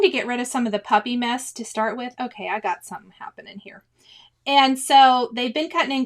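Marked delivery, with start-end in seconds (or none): 2.2-3.8 whispered aside. Real, not acted. Aside while fixing something on stream.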